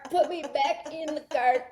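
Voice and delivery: slow, high begging dog; drawn-out mock plea. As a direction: stretch out the pitiful plea. Repeats dog's plea, drawn out.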